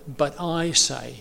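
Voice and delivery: slow "but I say", weight on "I"; emphasis on I. Retold or imitated. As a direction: stretch and stress the word "I". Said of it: "putting very heavy emphasis on the word I".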